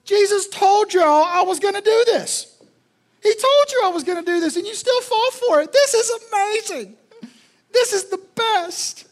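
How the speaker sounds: high, loud gloating devil voice; gleeful Satan. Retold or imitated. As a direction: crow like the devil delighted with himself. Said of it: "And he's like".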